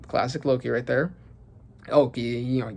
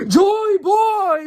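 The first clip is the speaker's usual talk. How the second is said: high, loud, drawn-out scream; 6 dB louder than usual. Acted. Reader acts Emu screaming at Joy Boy, screamed. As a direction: scream the name out at full pitch. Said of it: "fucking screaming".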